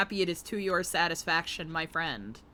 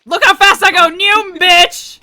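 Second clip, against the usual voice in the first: very loud, high boast; gleeful shouting; 10 semitones higher, 18 dB louder than usual. Real, not acted. Streamer flaunting turned-up speed, gleeful shout. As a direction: whoop it out, showing off.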